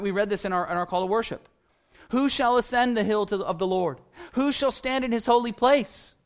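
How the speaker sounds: preaching at full force; fervent preaching. Acted. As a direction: drive the point home to the congregation.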